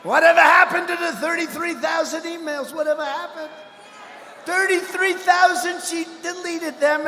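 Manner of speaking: taunting the opponent at a rally; mock rally shout. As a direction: belt it like a crowd chant. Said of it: "I was going".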